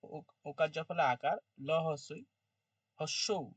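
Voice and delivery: slow, broken into separate syllables; staccato. Shown as a demonstration. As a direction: chop each part apart, slowly. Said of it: "ওইখানে একটু কেটে কেটে বলছি".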